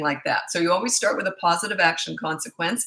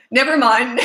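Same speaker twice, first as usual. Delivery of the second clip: loud and high, hastily dropping it; quick startled backpedal; 5 semitones higher, 8 dB louder than usual. Retold or imitated. Son caught by mom's look, hastily backs off. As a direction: blurt it out to take it back.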